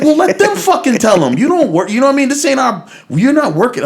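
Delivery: high-pitched, insistent telling-off; worked-up retold objection; exasperated protest. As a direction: argue it out, voice riding high. Teller argues friend should stay out.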